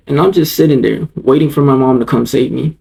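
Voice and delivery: plain reading voice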